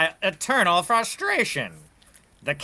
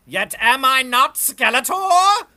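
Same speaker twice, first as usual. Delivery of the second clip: loud, high rhetorical cry; villainous boast; 5 semitones higher, 13 dB louder than usual. Acted. Villain boasting to his henchman, loud rising cry. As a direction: proclaim it grandly, rising to the name.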